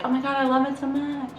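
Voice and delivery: gushing. Retold or imitated. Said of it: "I'm just like".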